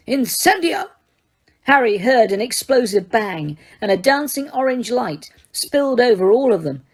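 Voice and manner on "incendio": high yelled fire spell. Acted. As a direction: fling the fire spell with a yell. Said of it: "Death Eater behind him yelled".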